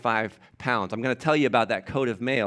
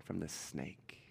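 hushed whisper, much softer; 10 semitones lower, 21 dB quieter, slower than usual. Acted. Preacher voicing David's faith, hushed whisper. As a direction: breathe it out as a secret. David's defiance, sermon climax.

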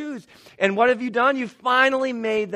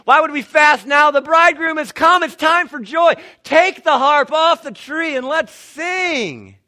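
higher, louder, joyful call; joyful proclamation; 7 semitones higher, 9 dB louder than usual. Acted. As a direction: call the people to celebrate. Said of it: "Jesus says that".

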